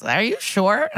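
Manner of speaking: leading question, pitch climbing sceptically at the end; mock doubtful. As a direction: imitate someone pointedly unconvinced. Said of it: "he does not want her to keep this baby".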